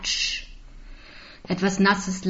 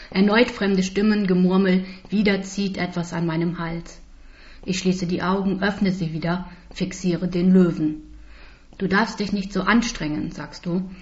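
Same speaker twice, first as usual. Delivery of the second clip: plain reading voice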